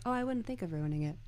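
quick, even deadpan; Colin Robinson deadpan. Retold or imitated. Fan imitating Colin Robinson's reply, quick deadpan. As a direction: toss it off quickly, without expression. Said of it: "just his deadpan".

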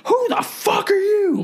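high, hammed-up screeching neighbor; screaming neighbor. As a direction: shriek it like an outraged guy. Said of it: "Just screaming".

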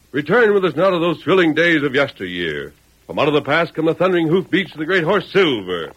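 rousing radio show announcer; big old-time radio intro. Acted.

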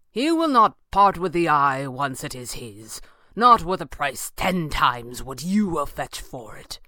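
grand, deliberate character voice; taunting villain. A narrator's reading. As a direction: open with soaring mockery, then settle into pointed warning.

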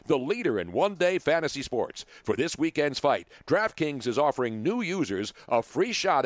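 polished, scripted sponsor read; announcer-style commercial delivery. Acted. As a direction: read it like a radio promo, smooth and punchy. Reads a sponsor ad.

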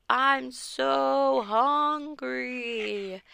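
very slow, drawn-out zombie moan; hammed-up monster groan; moaning ghoul. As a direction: stretch every word like a starving corpse. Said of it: "called out".

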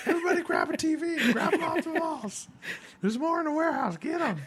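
high, laughing, hammed-up rallying cry; mock looting glee. Pretend employee urging looters, laughing high glee. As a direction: whip up a crowd of looters, laughing.

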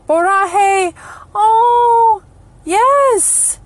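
high, loud burst of delight; light up with joy at the find; thrilled. Real, not acted. Spots Borahae print, thrilled.

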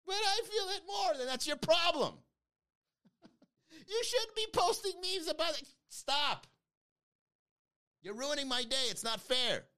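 the host mimics emotional critics in a whiny voice; whiny complainer. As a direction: whine like a self-pitying follower.

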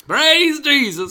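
high, slow, loud preacher cry. Pretend. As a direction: proclaim it like a TV evangelist. Mock preacher exclamation.

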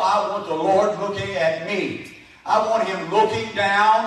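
normal talking voice

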